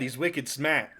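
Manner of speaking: put-on dropped-r Boston accent, lightly done; joking about Strahd in a Boston accent. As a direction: say it like a Boston local. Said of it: "the Vistani speak with a Boston accent".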